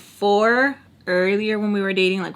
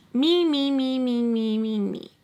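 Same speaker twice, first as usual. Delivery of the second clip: mocking babble; 4 semitones higher, 3 dB quieter, slower than usual. Her making fun of the ex's hurt feelings, mocking singsong. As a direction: start high and sink, fading.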